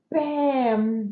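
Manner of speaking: ordinary talk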